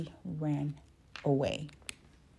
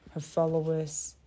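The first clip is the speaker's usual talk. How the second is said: usual speaking voice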